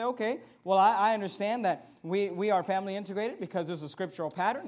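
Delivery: ordinary delivery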